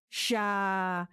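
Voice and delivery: a quick hard shush through the teeth; sharp shushing sound. Acted. Colonel silences Mason with a threat, a sharp shush. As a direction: cut him off with one sharp shush.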